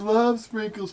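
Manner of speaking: high, sing-song mock of a sugary catchphrase; mocking Muji. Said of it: "like Muji says".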